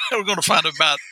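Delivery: breaking into giggles mid-line; laughing. Real, not acted. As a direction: try to say it but the laugh wins. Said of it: "Sorry, I can't do this with a straight face".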